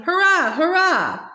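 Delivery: high, restrained cheer; shouting. A narrator's reading. Father cheering along with the stamping grandstand, mild shouting. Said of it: "Even Father was shouting".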